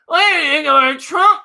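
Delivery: high, rising mock host question; mock talk show host. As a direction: ham up a lazy host's question. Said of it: "He sits behind the desk and goes".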